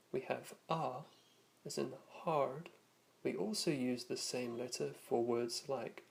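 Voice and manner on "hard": North American "hard" with sounded r; North American accent. Shown as a demonstration. Teacher recapping North American vowels, American r in "hard". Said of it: "North American style accent".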